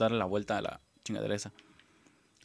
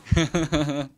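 loud laugh; 5 semitones higher, 10 dB louder than usual. Real, not acted. Laughs at glitched boss.

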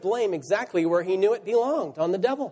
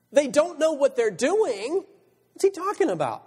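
incredulous; 6 semitones higher than usual. Acted. Preacher puzzling over Jesus forgiving his killers, incredulous. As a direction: question it as if baffled.

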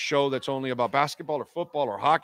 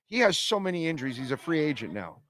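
usual speaking voice

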